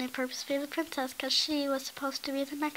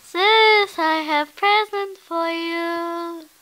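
calling out; 3 semitones higher, 11 dB louder, slower than usual. Acted. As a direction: shout across the castle. Calling sister.

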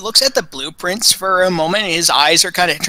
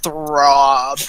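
ordinary delivery

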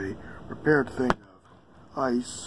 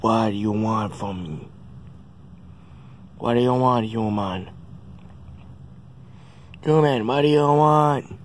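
louder, gruff pet voice; grumpy hamster voice; 4 dB louder than usual. Retold or imitated. Owner voicing his hamster demanding what the human wants, gruff. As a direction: play the annoyed little creature.

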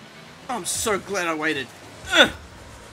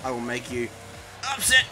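mock threat; 6 semitones lower than usual. Gamer mocking his own weak threat to an opponent, hammed-up menace. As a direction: deliver the lame threat as a big punchline. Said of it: "So my most threatening threat was".

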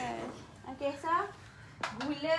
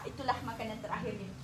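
ordinary talk

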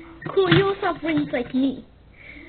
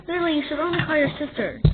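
ordinary delivery

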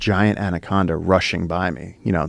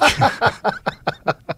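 burst of laughter. Real, not acted. Laughs after jumping on snake.

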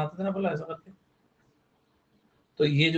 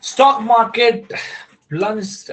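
slow dictation; 6 semitones higher, 7 dB louder, much slower than usual. Shown as a demonstration. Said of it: "dictating example sentence".

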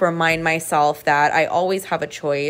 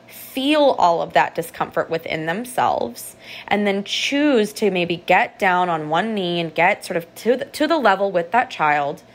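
ordinary delivery

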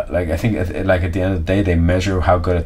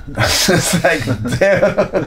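higher, laughing as you speak; laughing disbelief; 8 semitones higher, much slower than usual. Real, not acted. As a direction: laugh through the words.

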